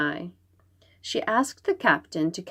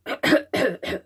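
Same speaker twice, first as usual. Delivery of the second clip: throat clearing; 4 semitones higher than usual. Acted. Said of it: "Nian coughed".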